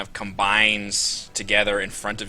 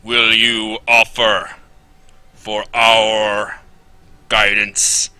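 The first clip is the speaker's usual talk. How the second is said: slow, much louder robotic boom; 10 dB louder, slower than usual. Acted. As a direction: boom it out like a steel guardian. GM voices the robotic champion.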